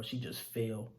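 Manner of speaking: normal talking voice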